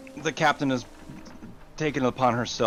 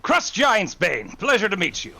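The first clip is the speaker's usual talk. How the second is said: grand introduction; 7 semitones higher, 3 dB louder than usual. Acted. Grand self-introduction in character.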